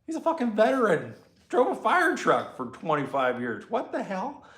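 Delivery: high, indignant disbelief; incredulous outburst. Retold or imitated. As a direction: protest in outrage that he was never arrested. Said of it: "We're like".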